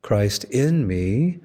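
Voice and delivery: slow, "in" held and rising in "Christ in me"; stress on in. Shown as a demonstration. Preacher explaining Christ within, stressing "in". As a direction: linger on "in" in "Christ in me". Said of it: "Christ for me can simply mean".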